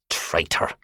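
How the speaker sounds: hissed whisper of scorn. A narrator's reading. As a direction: breathe the word out with contempt. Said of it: "said Miss Harbride".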